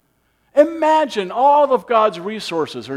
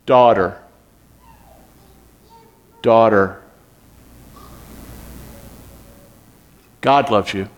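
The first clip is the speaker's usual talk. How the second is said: deep tender calling; tender voice of Jesus; 11 semitones lower than usual. Acted. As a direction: call her daughter like a loving father. Jesus speaking to healed woman.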